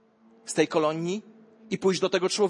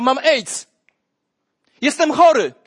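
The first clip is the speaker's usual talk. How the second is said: a higher, loud public admission; loud confession; 8 semitones higher, 10 dB louder than usual. Acted. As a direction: declare it openly to the crowd. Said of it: "i powiedział". (głośne, wyższe wyznanie choroby)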